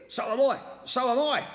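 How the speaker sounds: frightened and confused; alarmed. A narrator's reading. Mister Twit panicking about being upside down. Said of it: "cried Mister Twit".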